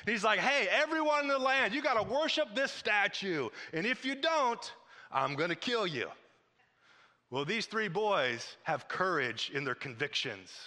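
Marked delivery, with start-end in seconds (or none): 0.4-6.1 high-pitched royal proclamation; hammed-up tyrant, pitched high then dropping for the threat; Nebuchadnezzar. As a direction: decree it to the whole land, drawing out the threat. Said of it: "this king by the name of Nebuchadnezzar".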